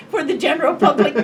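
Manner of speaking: high, loud real laughter around the words; laughing hard as she asks. Real, not acted. Asking the listener if it's too spooky, laughing out loud. Laughing at her own story.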